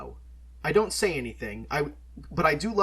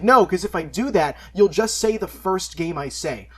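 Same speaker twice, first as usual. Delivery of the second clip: louder and higher, then slowing down; emphatic retold refusal; 5 semitones higher, 8 dB louder than usual. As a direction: insist firmly, spelling out the point.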